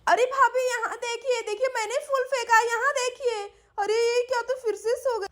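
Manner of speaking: high-pitched call to wake someone; urgent calling. Acted. Pinky trying to wake her sister-in-law, urgent calling.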